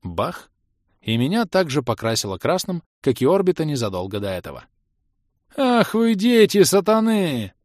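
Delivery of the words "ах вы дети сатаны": высокий громкий протяжный возглас; возопить со скорбью, растягивая слова; скорбный вопль. A narrator's reading. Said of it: "скорбно возопил скачущий к нам". (горестно и протяжно возопить)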